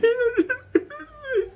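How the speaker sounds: high wailing half-cry laugh; whiny sobbing laugh. Retold or imitated. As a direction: break into a pathetic whimpering laugh. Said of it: "You start doing like that".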